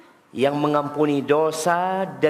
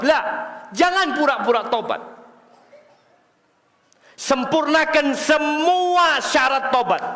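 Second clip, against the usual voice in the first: preaching shout; 8 semitones higher, slower than usual. Real, not acted. Preacher urges the congregation with a slow, raised shout. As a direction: drive home each word to the crowd.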